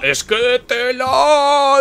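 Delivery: long high exasperated moan; drawn-out high wail. Real, not acted. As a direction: stretch every syllable up high in exasperation.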